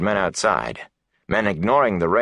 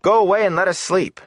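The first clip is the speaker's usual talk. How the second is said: high, louder nervous demand; frightened shout; 13 semitones higher, 4 dB louder than usual. A narrator's reading. Matt shouts at Goad to leave, high and scared. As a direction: push him away with your voice.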